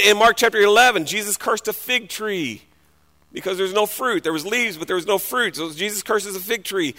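normal talking voice

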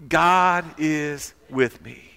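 slow, emphatic declaration; deliberate, stretched-out group affirmation; leading the repeat. Shown as a demonstration. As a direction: lead the crowd through it, drawing out words. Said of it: "Say it one more time".

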